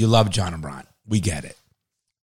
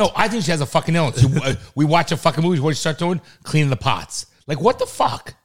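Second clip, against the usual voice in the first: rising exasperated rant, louder and higher; calm turning angry; 7 semitones higher, 5 dB louder than usual. Real, not acted. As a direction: vent building to a loud outburst. Ranting about mom cleaning.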